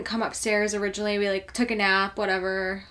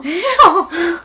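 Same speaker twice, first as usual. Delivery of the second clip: higher, louder laughing ew; 8 semitones higher, 11 dB louder than usual. Real, not acted. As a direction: squeal it while laughing. Laughing ew at bones.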